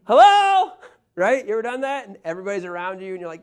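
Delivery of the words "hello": much higher, shouted hello into the phone; annoying loud phone call. Acted. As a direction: bellow it like the guy nobody wants beside them. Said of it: "you're the annoying guy".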